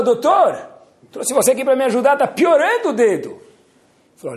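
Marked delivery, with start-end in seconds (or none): 0.0-3.3 high, loud complaining king; exasperated king. Acted. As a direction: demand help, fed up. King complains worsening finger. (rei irritado reclama com o médico, alto e agudo)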